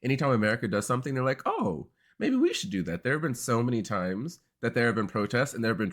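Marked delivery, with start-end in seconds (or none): 1.5-3.0 high, slow mock wonder; impressionable little brother. Australia voiced as little brother copying America, mock wonder. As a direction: imitate a copycat having a naive idea.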